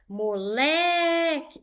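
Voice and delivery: "Moleque" with LE loud and very long; stress on LE. Shown as a demonstration. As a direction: belt and stretch the middle LE of "Moleque". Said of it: "Qual é a sílaba tônica mais forte?". ("Moleque" com o LE forte e bem prolongado)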